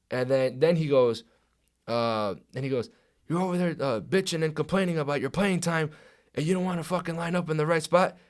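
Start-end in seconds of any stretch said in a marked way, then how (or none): 3.3-8.2 higher, sustained mock chewing-out; imitated coach's berating. Head coach berates lineman before team, imitated scolding. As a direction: tear into the player as his coach would.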